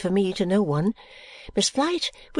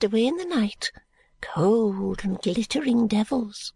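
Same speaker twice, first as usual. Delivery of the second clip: gloomy secret. Acted. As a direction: whisper it like a dark confession. Miss Flite confides secrets.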